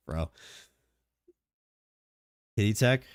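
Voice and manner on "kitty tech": ordinary delivery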